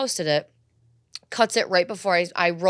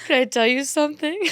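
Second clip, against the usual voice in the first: higher, fast, sing-song can I tell you; mock sweet boyfriend; 7 semitones higher, 4 dB louder, faster than usual. Boyfriend about to confess love, mock sappy. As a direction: play him as sappy and eager. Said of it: "And he goes".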